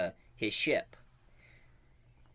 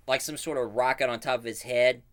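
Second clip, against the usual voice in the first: usual speaking voice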